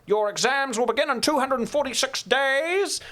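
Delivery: high, slow, stretched-out dorm master's speech; Kevin Connors. Retold or imitated. Dorm master charges boys with passing exams, imitated high drawling proclamation. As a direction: proclaim it like an old cowboy commander. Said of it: "That's how he talked".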